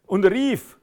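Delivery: loud cry; re-enacted loud call of Jesus; shouting. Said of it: "und schreit".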